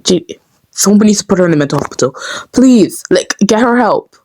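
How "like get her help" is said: usual speaking voice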